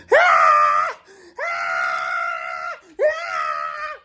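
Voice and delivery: high shrill mock screams of panic; terrified scream. First-time skydiver screaming in terror while falling, hammed-up scream. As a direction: scream like you're falling from the sky. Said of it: "I'm screaming like a little bitch".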